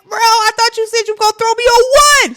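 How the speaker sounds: high, loud protesting yell; yelling at the instructor who threw her early; yelling in confusion. Retold or imitated. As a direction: shout it mid-fall in disbelief. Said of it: "I was yelling".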